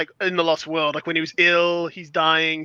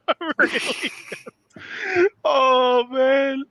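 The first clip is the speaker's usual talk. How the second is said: laughing; 7 semitones higher, much slower than usual. Real, not acted. Laughing helplessly.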